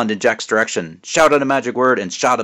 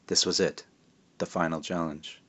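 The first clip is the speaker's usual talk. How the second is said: dropped to a near-whisper, softer, lower; hushed suspense; 4 semitones lower, 10 dB quieter than usual. A narrator's reading. Narrator hushes before the final challenge. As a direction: let it sink into an ominous hush.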